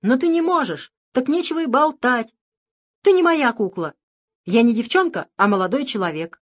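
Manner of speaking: put-on loud angry young man shouting. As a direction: yell it at the doll. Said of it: "и крикнул".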